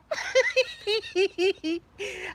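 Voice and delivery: high wheezy-sounding giggle fit; real high laughing fit; helpless laughter. Real, not acted. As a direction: dissolve into giggles. Laughing at her father's plight.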